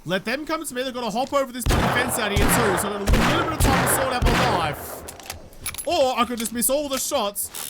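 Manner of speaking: ordinary delivery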